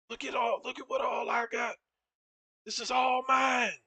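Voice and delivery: high, loud, wild shouting, slowing down at the end; crazed brother yelling. Retold or imitated. Brother shouting at the narrator about what he owns, wild yell. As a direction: bellow it like a man who has lost it.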